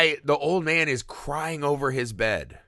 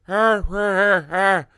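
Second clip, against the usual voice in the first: loud, high, pained monster groaning; monster groans; 6 semitones higher, 5 dB louder, slower than usual. Retold or imitated. The wounded monster groaning at a frightened family, pained and loud. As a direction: groan like a hurt, hungry creature. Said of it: "he comes out and he's just like".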